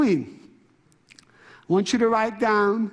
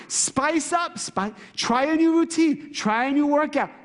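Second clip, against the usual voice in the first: rousing exhortation; 7 semitones higher than usual. Acted. Speaker urging the audience to spice things up, rousing. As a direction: urge them on, pitch held high. Urges audience with punchy slogans.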